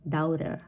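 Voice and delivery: daughter with both vowels sounded, DOW-ter; learner's mispronunciation. Shown as a demonstration. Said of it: "pronounce all of them".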